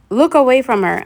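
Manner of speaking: sudden alarmed cry; shouted warning. A narrator's reading. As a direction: yell it to save them. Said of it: "Annabeth shouted".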